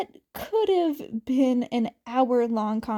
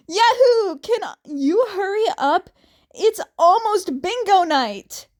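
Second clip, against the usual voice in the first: much higher and louder whoop; eager yell; 7 semitones higher, 6 dB louder than usual. Acted. Family member calling Jason home for bingo night, loud and excited. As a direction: hurry him home, bursting with glee.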